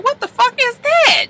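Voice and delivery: mock surprise. Hearing commotion outside.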